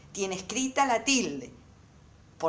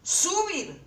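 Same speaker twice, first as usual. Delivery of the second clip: "Subir" high and drawn out, falling at the end; mimicked "Subir", pitched well above usual; imitating students' subir; 10 semitones higher than usual. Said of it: "Ustedes dicen".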